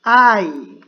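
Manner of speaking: "I" loud and drawn out; model pronunciation. Shown as a demonstration. Teacher has students repeat the pronouns, modelling "I" loudly. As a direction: say "I" slowly and loudly for repetition. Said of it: "aprendernos la pronunciación".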